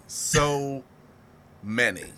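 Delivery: a dying echo of So many; drawn-out fade. Shown as a demonstration. Repeating a phrase for effect.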